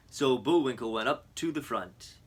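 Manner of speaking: usual speaking voice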